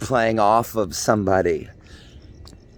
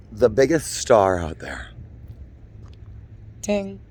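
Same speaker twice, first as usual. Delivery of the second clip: usual speaking voice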